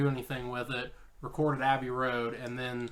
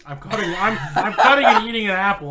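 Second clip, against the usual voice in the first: louder, giggling through words; laughing while talking; 5 semitones higher, 11 dB louder than usual. Real, not acted. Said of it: "You're just gonna hear chewing".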